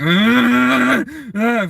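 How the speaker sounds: high loud screaming "Ah!"; mock scream. Girl pounding the dome, high loud mock scream. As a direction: shriek like the girl trapped in the dome. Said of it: "the teenage girl is screaming".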